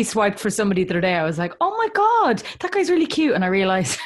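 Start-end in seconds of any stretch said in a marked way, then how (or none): 1.6-3.3 high-pitched gushing over a crush; retold giddy "oh my God" reaction; excited swooning. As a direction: gush it, pitched way up. Acting out excited swiping reaction.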